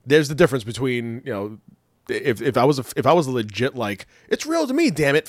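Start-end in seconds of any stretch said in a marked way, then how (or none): 4.3-5.2 high, indignant superfan outburst; diehard wrestling fan. Acted. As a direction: whine it like a true believer.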